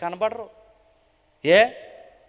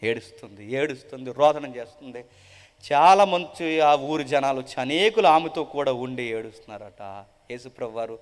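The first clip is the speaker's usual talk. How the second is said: ordinary talk, nothing marked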